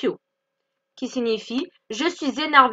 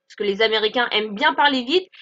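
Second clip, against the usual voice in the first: usual speaking voice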